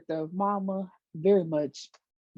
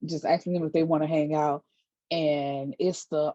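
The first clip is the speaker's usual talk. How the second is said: ordinary talk, nothing marked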